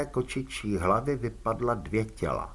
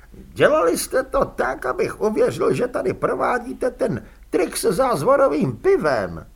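high, loud nervous complaining voice; a cornered little crook whining his grievance; whiny prisoner; 11 semitones higher, 6 dB louder than usual. A narrator's reading.

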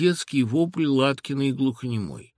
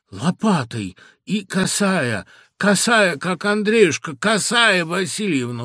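лепет; 5 semitones higher, 6 dB louder, slower than usual. A narrator's reading. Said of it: "лепетал он, не переставая корчиться".

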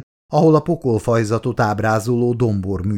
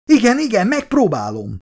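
panicked reply; 11 semitones higher than usual. A narrator's reading. Colleague answers the yelling doctor, panicked. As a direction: burst out high, then settle slightly.

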